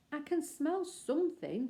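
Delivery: a hint of a small bear's voice, slow; lightly voiced bear. Bear sniffing at the window, lightly voiced bear. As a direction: sniff and announce it slowly.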